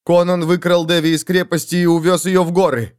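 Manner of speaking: blurted out. Acted. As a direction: blurt it out in a rush. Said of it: "выпалила она новости".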